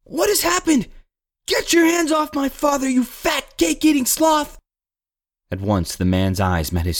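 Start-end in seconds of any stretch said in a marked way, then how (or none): 0.0-4.6 put-on very high, indignant child's voice; boy shouting. A narrator's reading. Boy orders the baker off his father, angry shout. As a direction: defend your father as an outraged boy. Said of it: "the boy shouted".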